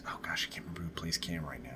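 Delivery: hushed whisper, trailing off as she forgets the name; whispered aside. Real, not acted. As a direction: drop to a whisper for a quick aside. Aside while forgetting actor name.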